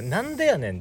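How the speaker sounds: a punchy Kansai comeback line; Kansai retort. Shown as a demonstration. Quoting the Kansai retort as a lively comeback. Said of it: "だ、".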